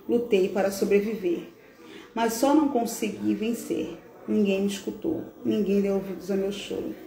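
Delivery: put-on heartfelt reading of a dead boy's farewell letter; a mournful poem read aloud over soft music; solemn recital. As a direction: recite it gently, as the lost child speaking to his father. Reads a reflection as Bernardo.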